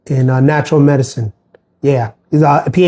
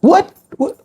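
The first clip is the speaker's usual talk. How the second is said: startled what; 14 semitones higher than usual. Retold or imitated. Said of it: "Threw me off".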